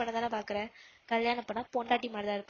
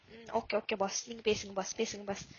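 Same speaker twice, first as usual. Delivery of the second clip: soft, low mock deference to the boss; mock submissive; 4 semitones lower, 4 dB quieter than usual. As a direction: back off with a teasing, quiet bow. (Pass கிட்ட கேலியான பணிவுடன் மெதுவாக)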